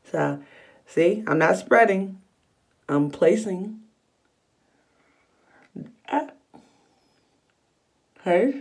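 lips pulled back over strip; talking while pressing teeth strip; fingers in mouth. Real, not acted. Seen 2: Both hands press a whitening strip onto her lower teeth, lips stretched.

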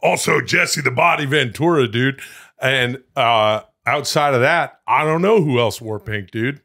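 rally through the names like a hype man; hyped-up bro. Real, not acted.

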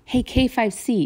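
ordinary delivery, nothing marked

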